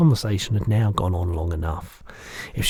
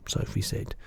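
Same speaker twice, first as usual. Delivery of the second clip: plain reading voice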